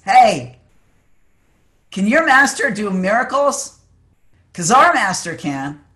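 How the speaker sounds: boastful call across a river, loud and high; yelling across. Retold or imitated. Rival student taunting the Buddhists over the river, yelling across. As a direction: call out a challenge over a distance. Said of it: "yells across and says".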